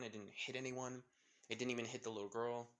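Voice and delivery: ordinary delivery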